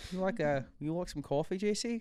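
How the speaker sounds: cartoonish Australian accent, teasing; mock Australian accent. Friend teasing co-host in a mock Australian accent. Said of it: "stop talking like a cartoon kangaroo".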